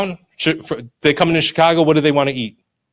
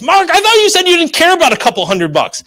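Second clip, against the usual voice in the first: high-pitched mock complaint; squeaky mock indignation; whining objector; 10 semitones higher than usual. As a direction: imitate a protesting listener, voice pushed high. Voicing an audience member's objection.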